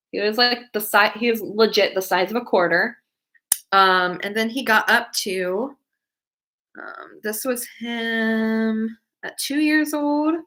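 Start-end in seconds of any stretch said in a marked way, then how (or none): none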